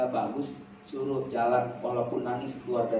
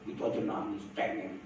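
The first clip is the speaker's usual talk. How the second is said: very soft, voiceless aside; imitated dismissive boyfriend; 11 dB quieter than usual. Man reacts to crying girlfriend, imitated soft dismissive jab. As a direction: scoff it under your breath.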